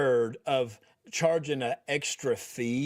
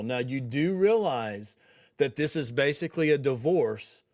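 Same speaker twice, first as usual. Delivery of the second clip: ordinary talk, nothing marked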